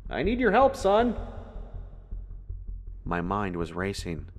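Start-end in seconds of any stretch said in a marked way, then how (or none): 0.1-1.2 high, insistent shout; father's high, urgent holler; yelling for help. A narrator's reading. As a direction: yell out for help from afar. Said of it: "Dad kept yelling".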